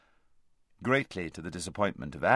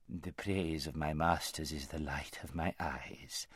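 oily scheming vizier; 3 semitones lower, 9 dB quieter than usual. Acted.

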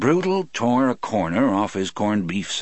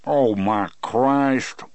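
slow, talking through a mouthful of sandwich; words muffled by chewing; mouth full; 4 semitones higher, 4 dB louder, much slower than usual. A narrator's reading. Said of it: "through a mouthful of corned beef sandwich".

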